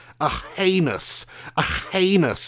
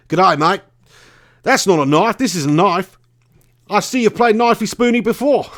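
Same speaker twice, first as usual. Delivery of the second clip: broad mock Australian accent, a bit higher; 5 semitones higher than usual. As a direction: play it up as a cheeky Aussie bloke, nearly laughing by the end. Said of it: "enough of the Aussie accent".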